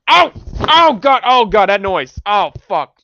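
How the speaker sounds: loud, high cry of pain; real pained outcry; pained yelp. Real, not acted. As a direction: yelp at the sudden noise. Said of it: "that bothered me so much".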